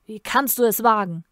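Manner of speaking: loud, forceful hissed outrage; seething imperious challenge; angry hiss. A narrator's reading. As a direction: hiss it hard at the offender. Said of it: "zischte der Imperator".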